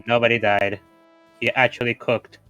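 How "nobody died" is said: ordinary talk, nothing marked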